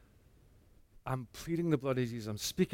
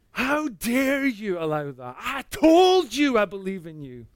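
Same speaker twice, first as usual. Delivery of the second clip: loud, higher, slow furious demand; 8 semitones higher, 10 dB louder, slower than usual. Retold or imitated. Angry man shouting at God after another night terror. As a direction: hurl it at God in outrage. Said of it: "Absolutely furious".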